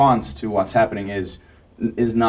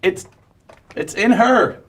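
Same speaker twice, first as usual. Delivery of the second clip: higher, halting, scared admission; high frightened confession; 9 semitones higher than usual. Acted. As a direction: stammer out the secret, scared. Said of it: "He said".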